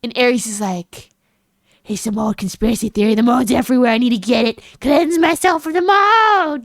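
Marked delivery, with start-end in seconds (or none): 1.8-6.6 hammed-up panic, swelling to a wail on the last word; mock conspiracy rant. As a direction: rave like a paranoid theorist. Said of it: "Yeah, exactly like that".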